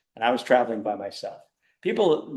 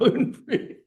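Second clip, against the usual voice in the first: laughing echo; 12 semitones higher than usual. Real, not acted. Echoing joke about snake meat.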